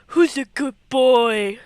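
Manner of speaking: put-on doggy baby talk. Shown as a demonstration. Player cooing at wolf Link, hammed-up doggy talk. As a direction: coo it at the wolf like a proud pet owner. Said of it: "first take contrasts".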